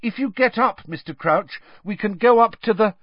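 urging. A narrator's reading. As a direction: urge him to get up. Harry urging Crouch.

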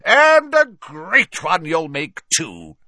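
high, loud, slow boom; booming Slughorn. A narrator's reading. Slughorn booming praise at Harry, loud and slow. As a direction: boom it out proudly. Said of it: "boomed Slughorn".

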